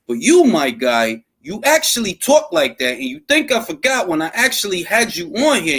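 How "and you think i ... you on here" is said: ordinary delivery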